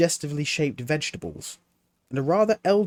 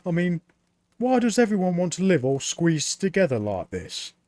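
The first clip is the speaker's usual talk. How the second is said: put-on higher, eager boy's voice; young Mort; 7 semitones higher, 4 dB louder than usual. A narrator's reading. Apprentice Mort questioning Death, higher boyish voice. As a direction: play the puzzled young apprentice.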